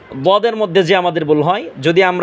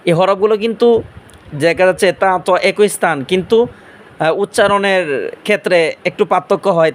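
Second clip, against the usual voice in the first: ordinary delivery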